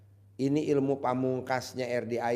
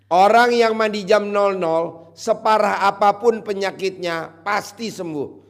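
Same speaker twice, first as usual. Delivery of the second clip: loud proclamation; 5 semitones higher, 7 dB louder than usual. Acted. Proclaims mock wisdom loudly.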